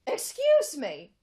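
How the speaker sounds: a British voice cutting in. Acted. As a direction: announce yourself in a posh British accent. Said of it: "A British voice was heard".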